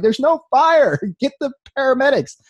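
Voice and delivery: high, loud mock yell with a laugh; exasperated yell. Shot man yelling at firemen to get the paramedics, high exasperated shout. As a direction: yell it in half-amused disbelief. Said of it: "I'm like".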